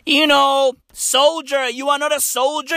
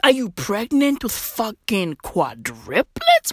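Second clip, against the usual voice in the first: ordinary talk, nothing marked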